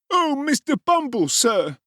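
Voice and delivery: high, loud feigned panic; agitated wail. Acted. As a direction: gasp out the beadle's name in put-on distress. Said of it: "tones so loud and agitated".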